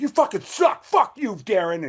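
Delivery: high-pitched mock rant, imitating an angry guy; really mad. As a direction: act out his outburst, high but not shouted. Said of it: "he got really mad at me".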